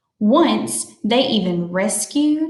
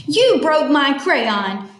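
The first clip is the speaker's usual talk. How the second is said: slow, higher crabby whine of complaint; crabbiest voice; 4 semitones higher, 4 dB louder, slower than usual. Acted. Tilly accuses her friend of breaking a crayon, in her crabbiest voice. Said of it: "Tilly said in her crabbiest voice".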